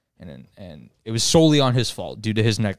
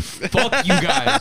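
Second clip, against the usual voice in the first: slow, high, stretched out through laughter; laughing mock insult; 12 semitones higher, much slower than usual. Real, not acted. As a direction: playfully curse the audience while giggling. Mock insult at booing chat.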